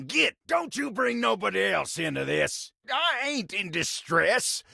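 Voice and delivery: high-pitched indignant country fussing; flustered outrage. Acted. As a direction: shoo them off, high and worked up. Caught in a mess, shooing visitor away.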